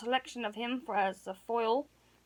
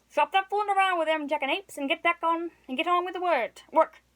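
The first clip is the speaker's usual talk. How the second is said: louder, a stern mock-scolding; father's wrath; 5 semitones higher, 4 dB louder than usual. A narrator's reading. Father scolding Sally for flirting, louder and stern. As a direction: scold her like a gruff but kindly dad. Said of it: "the full flood of her father's wrath".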